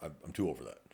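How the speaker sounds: low, soft, unbothered; calm, not yelling. Retold or imitated. Older officer to a yelling driver, calm and low. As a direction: refuse the shouting match, quietly. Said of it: "I'm not gonna yell".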